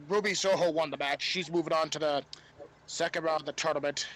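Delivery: ordinary talk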